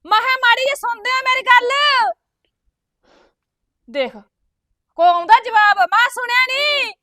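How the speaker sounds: calling out. Acted. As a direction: call him from across the house. Wife calls husband from afar. (ਉੱਚੀ ਹਾਕ ਮਾਰ ਕੇ ਪਤੀ ਨੂੰ ਬੁਲਾਉਣਾ)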